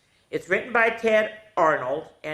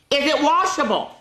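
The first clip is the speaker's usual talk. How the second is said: put-on high, bright child's question; small excited voice asking a guess; high little voice; 11 semitones higher, 5 dB louder than usual. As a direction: ask it like an eager little donkey.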